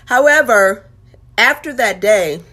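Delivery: normal talking voice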